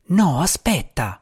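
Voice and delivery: fretful little djinn, unsure; nervous djinn cat. Acted. Il gatto la trattiene. (gatto djinn inquieto, voce più alta)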